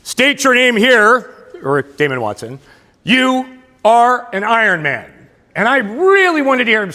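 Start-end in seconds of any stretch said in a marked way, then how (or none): 0.0-1.3 slow, drawn-out announcer call, higher and louder; stretched-out race announcer call; announcer's yell. Retold or imitated. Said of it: "the announcer yells". 3.0-5.1 announcer's call. Retold or imitated. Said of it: "the announcer yells".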